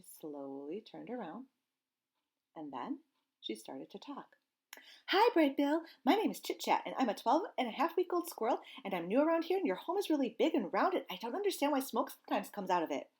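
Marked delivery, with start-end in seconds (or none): 5.1-13.1 high, louder, eager squirrel voice; a young squirrel babbling on; chattering squirrel. Acted. Squirrel chatters at the gosling, high and eager.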